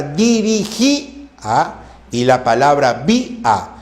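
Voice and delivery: "dirigía" and "vía" drawn out, each í split from its a; hiatus shown. Shown as a demonstration. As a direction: break "dirigía" and "vía" between í and a. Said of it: "Tengo dos hiatos acentuales".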